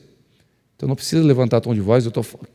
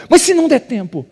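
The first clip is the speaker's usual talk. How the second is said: worried wife; 14 semitones higher than usual. Retold or imitated. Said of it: "ela tava preocupada".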